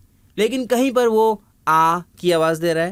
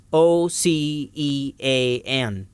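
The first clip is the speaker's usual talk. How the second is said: slow, separated letter names; spelling out; 3 semitones lower, much slower than usual. Shown as a demonstration. Teacher spells ocean for learners, slow letter by letter. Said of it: "ایک اور لفظ ہوتا ہے ocean".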